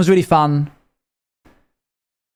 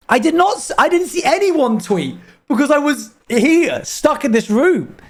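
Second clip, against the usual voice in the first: real excitement; 11 semitones higher than usual. Real, not acted. News of the Knicks win.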